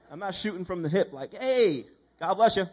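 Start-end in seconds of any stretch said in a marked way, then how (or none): 1.3-2.6 loud, hammed-up churchy greeting; preacher mocks an over-eager greeting, booming; mock loud blessing. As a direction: boom it like an over-eager believer.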